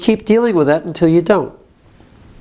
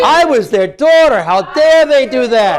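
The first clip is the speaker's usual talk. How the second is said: raised, indignant protest; modelled outrage; 3 semitones higher than usual. Shown as a demonstration. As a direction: demand it as if wronged.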